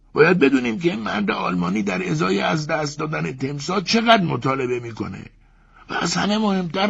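swallowed husband reasoning about compensation, high; Ivan from the crocodile. Acted. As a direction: lecture them from inside the beast. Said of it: "ایوان هم گفت".

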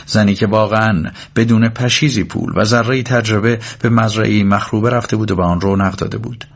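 ordinary delivery

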